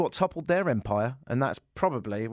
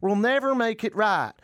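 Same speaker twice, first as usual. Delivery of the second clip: mock preacher; 8 semitones higher than usual. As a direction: pronounce it like a self-important pastor. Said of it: "Farias said".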